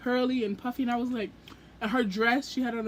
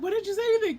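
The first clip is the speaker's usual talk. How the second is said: higher, tearful-sounding mock outcry; a lightly voiced friend wailing in dismay; imitating friend's outcry; 9 semitones higher, much faster than usual. Friend dismayed she missed the author, high mock wail.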